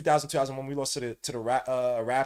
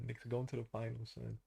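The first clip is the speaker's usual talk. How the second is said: stunned whisper-quiet; 15 dB quieter than usual. Real, not acted. Dazed disbelief before the win.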